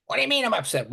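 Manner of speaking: mock upset outburst. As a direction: act out a mild, defensive protest. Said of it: "Well, you're yelling and screaming".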